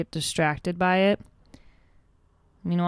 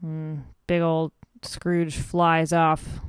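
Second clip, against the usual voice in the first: ordinary talk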